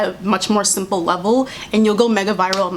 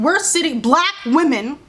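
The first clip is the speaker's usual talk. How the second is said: ordinary delivery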